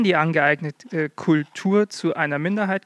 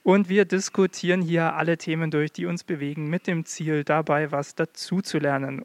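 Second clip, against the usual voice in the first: ordinary delivery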